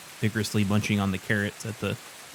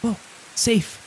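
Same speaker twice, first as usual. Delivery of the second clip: tiny bunny voice; 11 semitones higher than usual. Acted. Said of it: "kinda go".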